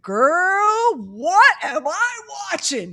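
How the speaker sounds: high, slow, outraged disbelief. Retold or imitated. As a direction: shriek it in appalled disbelief. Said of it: "He was so outraged".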